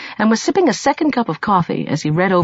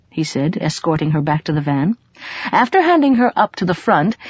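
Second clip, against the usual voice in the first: ordinary delivery, nothing marked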